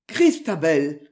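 ordinary delivery, nothing marked

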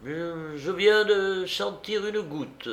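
hesitant, high and slow murmur; worried murmur. A narrator's reading. The commander feels a raindrop and murmurs anxiously. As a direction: falter on the first word, uneasy. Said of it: "murmura le commandant tout inquiet".

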